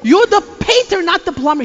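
indignant neighbor lady. Retold or imitated. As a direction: call him out in disbelief. Neighbor scolding the painter.

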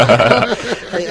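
a sudden loud laugh; loud burst of laughter. Real, not acted. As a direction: burst out laughing at the awkward truth. Laughing at awkward price moment.

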